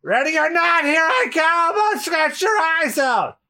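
high, slow feline threat; hissing cat pirate. Acted. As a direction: play a cat baring its claws. Cat guard charges in.

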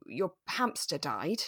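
normal talking voice

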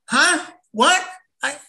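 loud, high baffled huh; baffled huh what. Retold or imitated. Masked customer blurting at cashier, loud and baffled. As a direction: blurt it out confused. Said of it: "he throws me another curveball".

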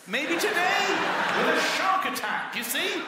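high, loud alarmed insistence; panicked refusal. Acted. Nervous tourist refuses his Australian friend's swim invitation, loudly and alarmed.

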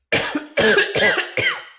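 high, loud staged cough; fake cough. Shown as a demonstration. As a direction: hack out a showy fake cough. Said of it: "You want me to do it right now?".

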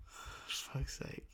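whispered, barely voiced curse; nervous whisper. Real, not acted. Steeling himself before the performance, whispered. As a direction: breathe it out under your breath.

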